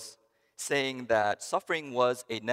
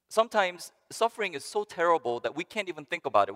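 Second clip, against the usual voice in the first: ordinary delivery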